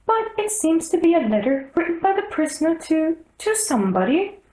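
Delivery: anxious little rabbit pleading to the king. A narrator's reading. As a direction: squeak it out in a flustered hurry.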